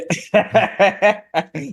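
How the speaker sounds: high laugh falling away; burst of laughter. Real, not acted. Laughs at own joke.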